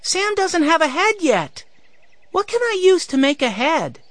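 put-on high-pitched six-year-old voice; small boy's high voice; excited little boy. A narrator's reading. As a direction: pipe up high, eager and concerned. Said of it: "Benny cried".

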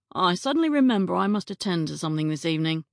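fast, brisk excuse; hurried excuse. Acted. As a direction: rush the excuse out. Kevin hurrying off.

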